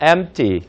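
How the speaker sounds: "empty" said slowly with a barely tapped p; light middle p. Shown as a demonstration. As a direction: slip lightly over the p in "empty". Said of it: "중간 자음은 가볍게 치시면서".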